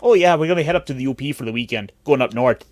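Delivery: Midwestern accent. Shown as a demonstration.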